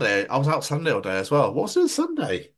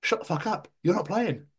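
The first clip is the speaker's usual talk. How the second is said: exasperated rant; 7 semitones higher than usual. Real, not acted. Ranting at the commentator.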